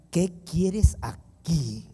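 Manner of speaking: slow, drawn-out, cold "¿Qué quieres aquí?"; "¿Qué quieres aquí?" said very slowly, coldly; cold stunned question. Retold or imitated. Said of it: "me dijo".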